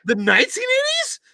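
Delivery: very high squeaky disbelief; mock shock. Mocking the teacher's claim: very high mock shock.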